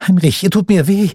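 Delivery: high, pained plea, half breathed; tearful plea. A narrator's reading. Margarete to her brother twisting her wrist, tearful pleading. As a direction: beg him to let go, hurting. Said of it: "flüsterte sie mit Tränen in den Augen".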